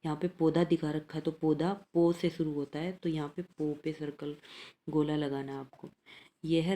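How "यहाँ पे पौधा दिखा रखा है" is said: usual speaking voice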